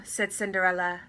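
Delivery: plain reading voice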